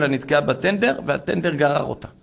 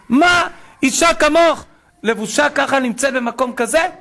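shouted astonishment; 11 semitones higher, 5 dB louder than usual. Retold or imitated. Terrorist confronting a religious woman at the party, shouting in disbelief.

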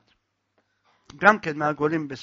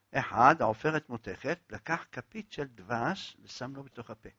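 ordinary delivery

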